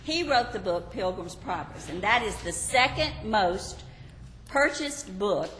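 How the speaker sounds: normal talking voice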